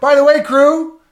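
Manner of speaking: host hailing the crew mid-show, loud and slow; calling out to the crew. Real, not acted. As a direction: announce it to the whole audience. Calling out to the audience.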